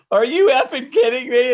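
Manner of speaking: loud, high disbelief; a mechanic shouting at a neat trick; amazed shout. Retold or imitated. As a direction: yell it out in amazement. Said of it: "he's going".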